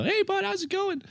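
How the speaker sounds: very high put-on Maine buddy greeting; squeaky impression of a Maine local; high-pitched Maine voice. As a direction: mimic the Mainers in a high falsetto. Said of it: "I'm doing this voice now".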